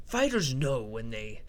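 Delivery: a faint hint of the Joker's creepy delivery; mild Joker impression mocking a fighter. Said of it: "I'm doing a little Joker accent".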